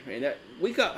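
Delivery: ordinary talk, nothing marked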